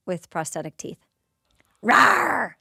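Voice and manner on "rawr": mock growl. Imitating monster fight.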